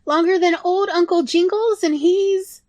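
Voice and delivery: easy, breezy yes; high little rat voice; chirpy rat. Acted.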